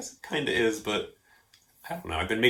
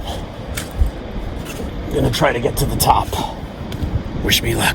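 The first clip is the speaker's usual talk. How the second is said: slow, breathy whisper; whispered resolve; 4 dB louder than usual. Acted. Character sneaking up a hill, slow whisper. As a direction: whisper it like a secret plan.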